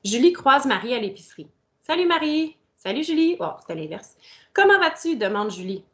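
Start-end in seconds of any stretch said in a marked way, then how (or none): 1.9-3.4 cheerful greetings. Acted. 4.5-5.1 bright character question. Acted.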